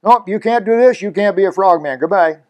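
loud, high curt rejection; blunt dismissal. Retold or imitated. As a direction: shut them down sharply. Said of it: "I said to them".

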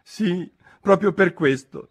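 high, sighed confirmation; resigned, high Hatter voice; sighing. A narrator's reading. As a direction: say it with a weary sigh. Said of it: "con un sospiro".

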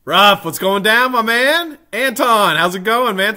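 loud, high-pitched hello; suddenly spot a friend and call out; excited shout-out. Real, not acted. Streamer calls out greetings to viewers.

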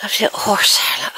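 whispered aside. Real, not acted. Pointing out the horse, whispered. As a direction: breathe it, barely voiced.

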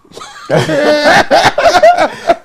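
loud high burst of laughter; big laugh. Real, not acted. Host bursting into loud laughter on air. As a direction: let out a loud hearty laugh.

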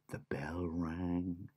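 soft slow whisper. A narrator's reading. Narrator whispering the shop bell. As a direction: hush the moment.

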